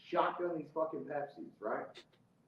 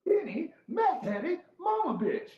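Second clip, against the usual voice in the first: high, loud, slow announcement; triumphant reveal; 14 semitones higher, 5 dB louder, slower than usual. Real, not acted. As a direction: draw out each word for the punchline. Gleeful answer to his own question.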